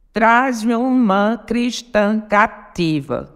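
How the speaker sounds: very slow, syllable by syllable; contando sílabas. Shown as a demonstration. (escandindo devagar, sílaba por sílaba)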